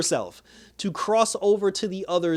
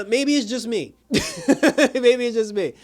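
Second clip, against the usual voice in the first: laughing; 7 semitones higher, 4 dB louder than usual. Real, not acted. Laughs at own rant.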